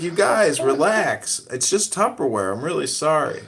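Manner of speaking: trying to settle them down; pleading calm. Retold or imitated. Guest begging the couple to relax over Tupperware, pleading. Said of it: "I was like".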